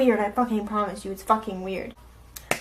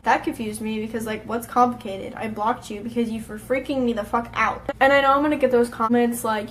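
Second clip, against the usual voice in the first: ordinary talk, nothing marked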